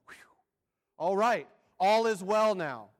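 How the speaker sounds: loud, drawn-out relief after a whew; mock relief. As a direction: breathe out, then proclaim it loudly and slowly. Acts out relief at stimulus news.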